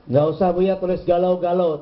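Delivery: ordinary delivery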